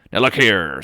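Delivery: loud, gruff warning; stern captain. Acted. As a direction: cut him off hard. Captain confronts the visitor.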